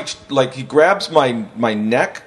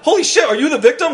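surprised cops; 12 semitones higher than usual. Retold or imitated. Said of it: "They're like".

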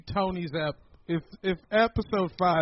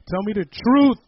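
loud, higher-pitched mock interrogation; mock outraged demand; 7 semitones higher, 9 dB louder than usual. Podcaster jokingly accuses co-hosts of lying, mock outraged demand. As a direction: shout it with heavy punch on the last word.

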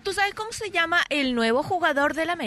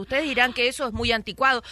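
ordinary talk, nothing marked

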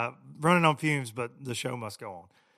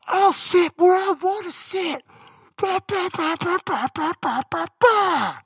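much higher and louder, slow, imitating the crazy passenger; mock yelling; 11 dB louder, slower than usual. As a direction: shout over the plane like the troublemaker. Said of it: "a voice going louder than it should be".

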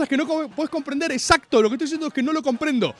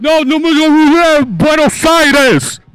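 loud, slow, slurred mock stage banter; mock Caribbean singer chatter; 3 semitones higher, 9 dB louder, much slower than usual. Mocking [name] and [name] on stage, loud slurred banter. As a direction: ham up the singers' garbled talk. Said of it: "la conversación en el escenario es tipo".

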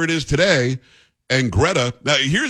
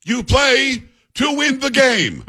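much higher, slow, yelled coach's catchphrase; Herm Edwards shout; 11 semitones higher, 3 dB louder, slower than usual. Retold or imitated. Coach answering a reporter's question, yelling it slowly. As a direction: bellow it like an angry coach at a presser. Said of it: "who was the coach ... who said".

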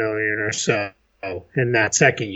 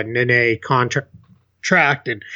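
ordinary talk

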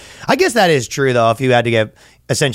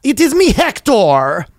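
slow, higher, put-on announcing voice; mock alter voice; 10 semitones higher, slower than usual. Comedian acting out an alter named Hector, slow and pompous. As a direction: introduce himself as a grand alter, slowly. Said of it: "Hector can't be just".

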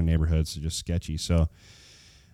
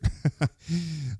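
self-mocking laugh; 9 semitones higher, 4 dB quieter than usual. Real, not acted. Laughing at own shorts.

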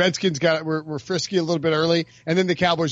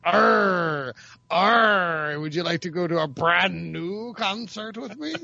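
growly pirate arr; much slower than usual. Pretend. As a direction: ham it up like a pirate. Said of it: "What's the pirate voice like?".

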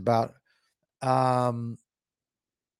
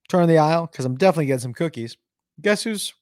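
usual speaking voice

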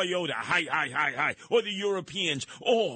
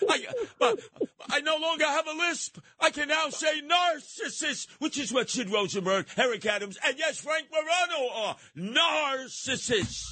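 mock tearful joy; 11 semitones higher than usual. Mock joy at losing his lisp.

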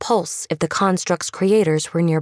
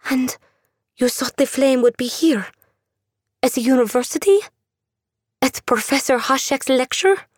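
put-on higher, suspicious girl's voice; a scared girl demanding answers; wary young woman; 8 semitones higher than usual. Andula voiced by narrator.

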